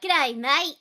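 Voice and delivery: a quick stock Australian hello; Aussie accent. Shown as a demonstration. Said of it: "the only thing I can say is".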